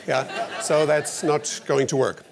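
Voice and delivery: normal talking voice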